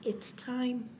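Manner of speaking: ordinary delivery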